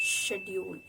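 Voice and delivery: schedule as British shed-yool; schedule in a British accent; British pronunciation. Shown as a demonstration. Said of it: "the Britishers pronounced it as".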